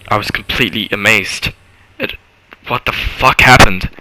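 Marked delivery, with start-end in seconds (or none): none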